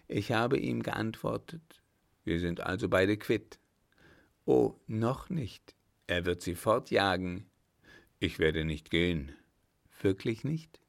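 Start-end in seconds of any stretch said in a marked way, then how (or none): none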